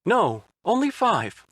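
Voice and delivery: high, slow nervous voice; nervous young man. A narrator's reading. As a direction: answer timidly. Otacon voiced by narrator.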